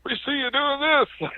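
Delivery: high teasing woman's voice; neighbor woman. Retold or imitated. Neighbor lady teasing him about his digging, high and sing-song. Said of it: "she goes".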